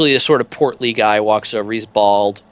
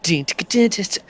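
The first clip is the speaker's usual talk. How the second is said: mouth sound effects; 9 semitones higher than usual. Retold or imitated. Imitating montage sounds.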